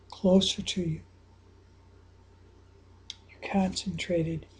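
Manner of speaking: low, soft hushed guiding voice; guided visualization. Acted. Coach guiding a listener through a free throw, hushed and soft. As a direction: ease them into focus, half whispered.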